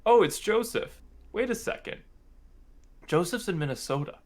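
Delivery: high, halting imitation of mom reasoning; lightly voiced bewildered mother; puzzled mom thinking. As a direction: talk yourself through the confusion as mom. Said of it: "she's like".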